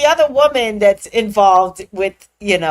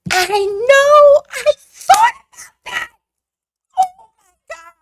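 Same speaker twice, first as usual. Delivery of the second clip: high, squealing through tears of laughter; losing it, laughing till crying; 6 dB louder than usual. Real, not acted. Recalling jail joke to friends, laugh-crying.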